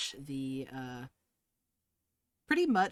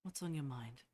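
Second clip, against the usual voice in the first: quietly; 5 dB quieter than usual. A narrator's reading. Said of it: "quietly says".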